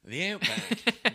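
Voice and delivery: drawn-out Outback. Pretend. Friend drawling the Outback steakhouse name for laughs. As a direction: stretch out "The outback" like a grand announcer.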